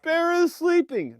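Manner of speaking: put-on high, slow child's voice insisting; imitating tearful child. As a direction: whimper like a small boy who won't believe it. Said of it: "Why are you crying?".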